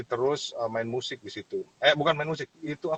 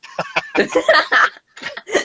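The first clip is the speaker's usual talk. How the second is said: loud, high burst of laughter; 11 dB louder than usual. Real, not acted. Admitting he hid in the crowd, loud laugh.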